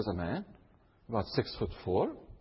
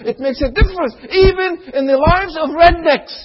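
fervent preaching; 14 semitones higher, 15 dB louder than usual. Acted. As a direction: preach it out with full force. Preaching at full force.

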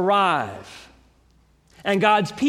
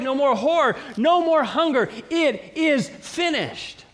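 higher, ringing pulpit proclamation; preaching at full force; 5 semitones higher than usual. Acted. Preacher rallying the congregation about the end, full preaching voice. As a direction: build it up toward the climax. Building sermon climax.

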